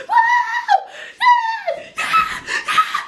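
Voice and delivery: very high cheering yells; squealing yeah yeah yeah; ecstatic cheers. Real, not acted. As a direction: whoop with pure joy. Cheering for joy.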